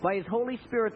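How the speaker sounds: ordinary talk, nothing marked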